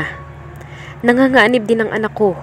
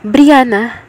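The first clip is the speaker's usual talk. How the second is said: angry hiss; 5 semitones higher, 7 dB louder than usual. A narrator's reading. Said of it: "he hissed".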